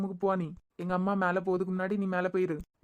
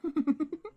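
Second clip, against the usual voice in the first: a short higher laugh of relief; happy laugh; 9 semitones higher than usual. Real, not acted. Wife laughing with relief on hearing the news, a happy laugh. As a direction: laugh out, delighted.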